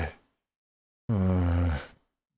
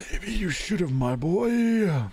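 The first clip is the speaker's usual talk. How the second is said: sinister mock voice; 7 semitones higher, much slower than usual. Mocking voice answers narration.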